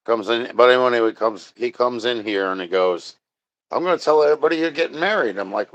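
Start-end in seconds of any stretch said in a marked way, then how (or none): 3.7-5.4 quick, pitched-up taunting friend; teasing friend. Retold or imitated. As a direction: rattle it off like a gleeful tease. Friend's teasing quoted, fast.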